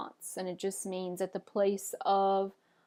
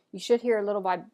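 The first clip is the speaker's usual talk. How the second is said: usual speaking voice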